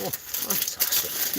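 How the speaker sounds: high, slow hushed fantastic; mock delight. Host gushing over the onion rings in a hushed, high voice.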